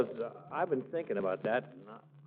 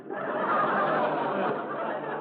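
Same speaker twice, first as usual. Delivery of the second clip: breathy scornful chuckle; mocking laugh; 6 semitones higher, 3 dB louder than usual. Floyd laughs at Gildersleeve outside, mocking chuckle. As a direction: laugh him off under your breath.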